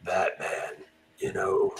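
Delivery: low, hushed, husky Batman voice; rough near-whisper, deep and dark. Retold or imitated. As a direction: drop it low and soft like Batman. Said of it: "really kinda like".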